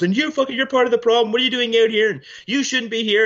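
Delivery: high, loud mock shriek of an angry woman; angry screaming lady. Retelling a woman screaming at a man outside, high mock shriek. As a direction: screech it like the furious lady at the pump.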